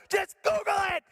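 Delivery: high, emphatic frantic order; mock exasperated yell. Husband cutting off wife's questions, high exasperated mock yell. Husband yells at wife, retold.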